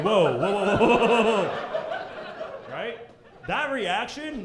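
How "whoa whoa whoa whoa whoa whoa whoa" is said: louder laughing whoas; hammed-up calming whoas; mock backing off. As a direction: back off fast in mock alarm. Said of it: "You're like".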